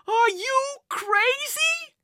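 very high, slow, loud mother's shriek; outraged shout. A narrator's reading. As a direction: shout it in disbelief at your son. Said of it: "Missus Eberly shouted".

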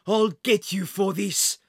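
slow, furious villain threat; angry threat. A narrator's reading. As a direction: spit the threat at him. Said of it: "the man screamed at Jack".

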